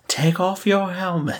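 playful put-on commanding voice; mock forceful. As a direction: imitate a forceful order for laughs. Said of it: "say it kind of forceful".

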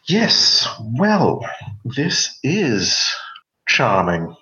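put-on plummy cut glass English accent, drawn out. Noblewoman sniffing at the players in cut glass English accent. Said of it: "she says in this very cut glass, uh, accent".

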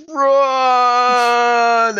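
high, loud yell to flee; shouted run. Acted. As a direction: scream it out as you bolt for the exit. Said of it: "And L J's like".

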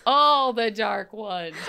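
very slow, drawn-out echo; mock-dramatic title. Co-host echoes the episode title, slow and mock-dramatic. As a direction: stretch every word like a big reveal. Echoing the co-host's title announcement.

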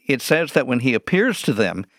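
usual speaking voice

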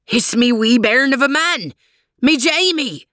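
Scottish cry, pitch rising; broad Scottish accent. Acted. Jock finds his dead man.

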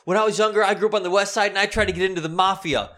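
high, loud impression of her tirade; ranting neighbor lady. Lady's life story continues.

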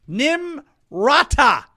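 slow, drawn-out name; lingering on the name with scorn. Real, not acted. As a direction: stretch the name out mockingly. Dwelling on her real name.

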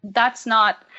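ordinary delivery

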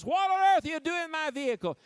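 high, loud, startled yell at car thieves; alarmed owner shouting. Retold or imitated. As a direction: shout it like the owner running out of the house.

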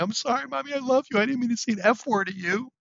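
high mock-sobbing child voice, lightly done; hysterical crying kid. Six-year-old sobbing apology to his mom, high and mock-tearful. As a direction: imitate a small boy's tearful apology. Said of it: "he was hysterical, crying".

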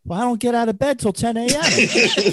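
very high comic punchline; Gilbert Gottfried impression. Storyteller mimics comedian's patient landing punchline, high impression. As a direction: drive the punchline home high and strained. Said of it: "He goes".

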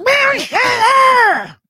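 high, slow shouted villain voice; cartoon villain. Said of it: "You sound like a cartoon villain".

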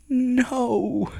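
high-pitched whimpered no; mock crying. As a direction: imitate a drawn-out, tearful squeak. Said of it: "I'm, like, crying and shit".

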